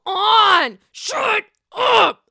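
loud, high mock shout, escalating; fed-up yelling. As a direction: yell over a brawl like an exasperated host. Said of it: "Andy just can't take it".

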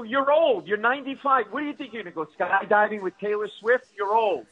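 high, incredulous comic rant; exasperated retort. Acted. Son arguing with his mother aged ninety-five, exasperated.

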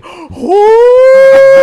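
shrieked high, loud mock awe; awed crowd gasp. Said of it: "everyone's like".

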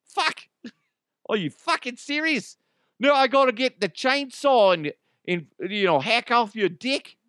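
high, loud Aussie firefighter; hammed-up Australian disbelief; Australian firefighter scoffing at the patient; mock Australian accent. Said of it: "it's like".

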